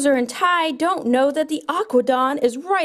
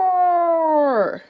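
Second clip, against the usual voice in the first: a roaring sea monster. Pretend. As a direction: roar like the Aquadon. Sea monster toy attacks.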